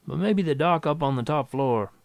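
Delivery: lightly drawling official; drawled. A narrator's reading. Official sending a frantic musician upstairs, drawling. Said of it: "he drawled".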